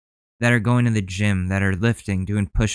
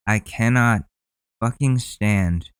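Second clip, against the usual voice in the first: ordinary talk, nothing marked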